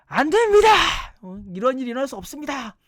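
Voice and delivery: high, loud cry of refusal; acted protest. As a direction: burst out in forceful objection, starting at a shout. Acting Peter's outburst against Jesus.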